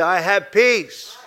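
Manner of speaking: very slow, model the line for them to repeat; slow call-and-response. Shown as a demonstration. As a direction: lead the congregation, slow and firm.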